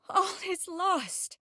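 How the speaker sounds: high, slow cry of defeat; despair. Acted. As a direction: let it drag out in high-pitched despair. Despairing at failure.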